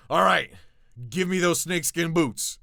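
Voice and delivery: hammed-up robber waving a knife; bandit demand. Bandit pulling a knife to demand snakeskin boots, loud mock threat. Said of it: "he's like".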